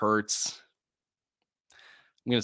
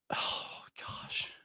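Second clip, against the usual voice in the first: shaken whisper; 14 dB quieter than usual. Real, not acted. Upset after a work call.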